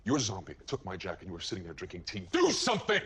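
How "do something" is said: high, loud outburst; explode, demanding action; angry shout. Acted. Father demands the agency rescue his son, angry shout. Father outraged over taken baby.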